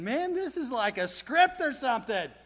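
high, insistent grumbling; told-you-so disciples. Acted. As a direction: complain in a repeated sing-song protest, building. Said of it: "And they're saying".

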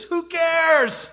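exasperated cry to the audience about the naked statue; mock exasperation. Real, not acted. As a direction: throw it away with a scoff.

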